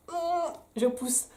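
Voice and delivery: high, slow strained push; mock straining. Mother acting herself pushing anyway, mock strain. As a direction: squeeze it out as if pushing hard.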